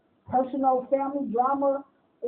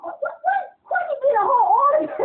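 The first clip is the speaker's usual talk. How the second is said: high, quick mock protest building louder; hammed-up tattling complaint, pitched up; indignant classmate; 10 semitones higher, 4 dB louder than usual. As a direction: mimic a student crying unfair. Acted classmate objecting to unfairness.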